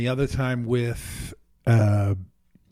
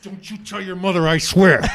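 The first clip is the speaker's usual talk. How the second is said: mock threat; 6 semitones higher, 7 dB louder than usual. Actress pins him to wall.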